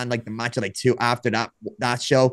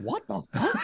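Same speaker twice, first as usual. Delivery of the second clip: higher, softer, laughing incredulity; baffled disbelief; 8 semitones higher, 6 dB quieter than usual. Retold or imitated. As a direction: retell a stunned reaction, breaking into laughter. Stunned reply to wild backflip plan.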